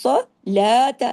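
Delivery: صلاۃ with a long لا and a clipped ۃ; short final ta. Shown as a demonstration. Teacher isolates the word so students hear it, slow. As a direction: stretch لا, cut the ۃ short. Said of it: "لیکن تا کو نہیں کھینچنا".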